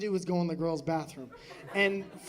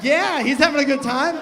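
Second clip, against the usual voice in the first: strong person; 5 semitones higher, 9 dB louder than usual. Acted. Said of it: "one real strong person was like".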